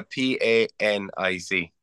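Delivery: letters "P A N I C" spelled one by one, slowly; spelling it out slowly. Real, not acted. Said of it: "No, uh".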